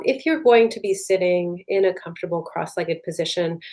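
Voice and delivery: ordinary delivery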